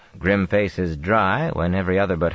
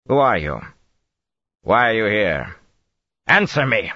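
louder, sharp questions; Tarabana accent, demanding; 5 semitones higher, 4 dB louder than usual. A narrator's reading. Shielded Aes Sedai confronting Rand, Tarabana accent demanding. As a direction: demand answers, losing composure.

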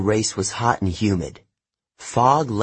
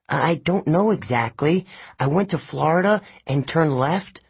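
higher, fretful character voice; anxious Grover; 6 semitones higher than usual. Acted. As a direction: play a stranded friend pleading for help.

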